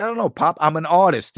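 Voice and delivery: high-pitched imitated protest; hammed-up artist's complaint; mock pleading son. Acts out himself pleading with father.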